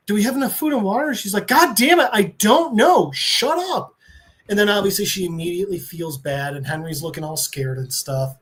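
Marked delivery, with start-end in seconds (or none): 0.1-1.2 quick, higher pestering question; rapid-fire child's question, pitched up; typical kid. Retold or imitated. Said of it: "doing the typical kid stuff". 1.5-4.0 high, loud acted outburst; mock fed-up yell; exasperated snap. Sister snaps at pestering brother.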